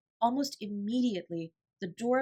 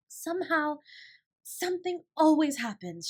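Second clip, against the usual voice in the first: high, louder joyful outburst; glad cry; 8 semitones higher, 6 dB louder than usual. A narrator's reading. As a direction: marvel at the magic. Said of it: "she cried".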